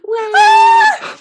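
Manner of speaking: ecstatic high shriek; joyous shriek. Acted. Character cheering as the door swings open, shrieking. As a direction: burst out in a crying shout of joy.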